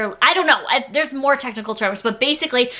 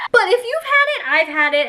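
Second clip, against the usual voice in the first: laughing; 11 semitones higher than usual. Real, not acted. Laughs about worm kid.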